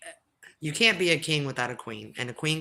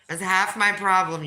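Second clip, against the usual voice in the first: higher, louder repeat; louder emphatic; 6 semitones higher, 9 dB louder than usual. Real, not acted. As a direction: punch it out louder.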